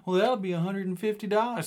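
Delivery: high, put-on voice for the tow guy; tow truck driver. Tow driver quoting price.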